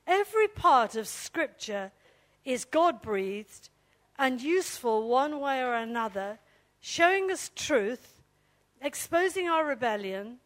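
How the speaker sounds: loud, slow, emphatic Bible reading; scripture reading. Real, not acted. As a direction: proclaim each phrase slowly, pausing between them. Reads scripture aloud to congregation.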